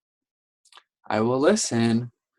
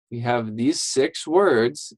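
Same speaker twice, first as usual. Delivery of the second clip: ordinary talk, nothing marked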